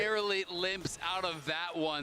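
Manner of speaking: calling the fight live; esports caster. Acted.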